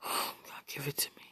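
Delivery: hushed, intimate plea; breathy pleading whisper. Real, not acted. Pleading with a lover over the phone, whispered. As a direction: sigh it out in a whisper.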